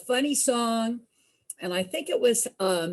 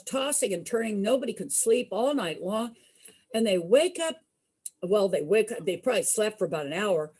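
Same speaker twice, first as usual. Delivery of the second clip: usual speaking voice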